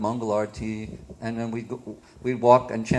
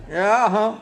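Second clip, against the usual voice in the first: put-on loud, raised Southern old-timer voice; old campground proprietor; 9 semitones higher, 6 dB louder than usual. Campground owner greeting the boys, loud Southern old-timer voice.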